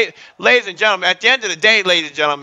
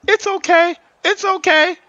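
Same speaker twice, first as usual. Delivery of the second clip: dog comforting; 11 semitones higher than usual. Retold or imitated. Dog soothing him after kick.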